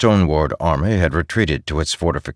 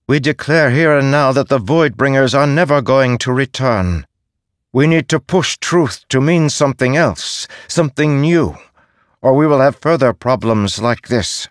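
loud, higher declaration; proclaiming leader; 8 semitones higher, 5 dB louder than usual. Acted. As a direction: announce it to the council. Giro proclaims decree.

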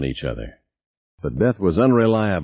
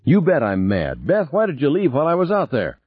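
angry retort, rising to a high demand; mad; 8 semitones higher than usual. A narrator's reading. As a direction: demand an answer, mildly angry. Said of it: "You sound mad".

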